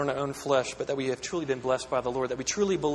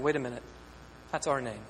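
mock dismay; 7 semitones higher than usual. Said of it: "as Israel going".

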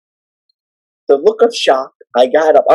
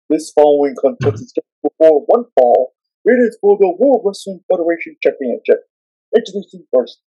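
Howard Finkel; 3 semitones higher, 4 dB louder than usual. Retold or imitated. Fan shows the announcer his impression, big ring-announcer call. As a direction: boom it out to the arena. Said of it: "I can do your voice, Howard".

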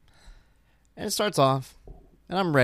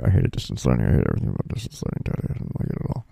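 very low, droning mimicked grumble; exaggerated low, surly monotone; sullen daughter; 12 semitones lower, faster than usual. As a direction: imitate a kid grumbling right into the mic. Daughter close to mic, imitated.

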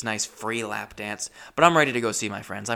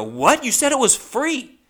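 loud, much higher indignant protest; mock outraged yelp, pitched way up; raised voice; 13 semitones higher, 6 dB louder than usual. Comic replays arguing with a dancer over the price, raised voice. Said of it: "I'm raising my voice now in the strip club".

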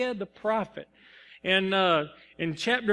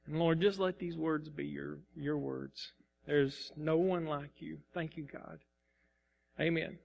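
softer, earnest prayer voice; quiet prayer; 4 semitones lower, 3 dB quieter than usual. Real, not acted. Nervous pastor praying before preaching, quiet and earnest. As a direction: pray quietly, asking for calm. Prays aloud before the sermon.

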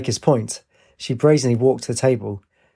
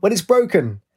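sudden, higher-pitched blurt; 8 semitones higher than usual. A narrator's reading. Flustered student whose brain froze answering a quiz, blurting. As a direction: burst out with it, pitched up. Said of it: "she blurted out".

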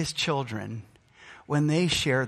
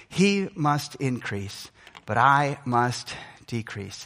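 slow solemn quote; 3 semitones lower, slower than usual. Acted. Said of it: "quoting John slowly".

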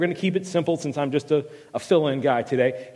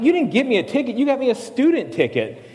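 incredulous protest; 7 semitones higher than usual. Retold or imitated. Shocked at ticket from friend.

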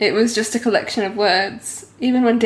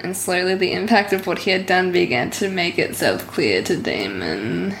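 ordinary talk, nothing marked

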